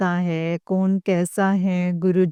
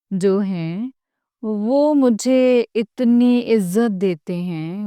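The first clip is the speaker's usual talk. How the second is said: ordinary talk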